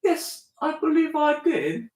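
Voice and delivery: high, slow mock mid-Atlantic accent; high, drawn-out mock British accent. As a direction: play an old-film announcer, slowly. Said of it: "mid-Atlantic accent".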